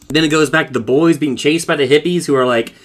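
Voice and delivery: usual speaking voice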